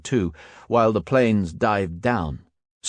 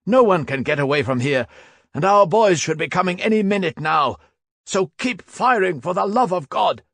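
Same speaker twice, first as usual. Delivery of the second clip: high, loud insistent shout; urgent shouting; 10 semitones higher, 4 dB louder than usual. Acted. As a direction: order them to hold, yelling. Stabs shouting orders under fire.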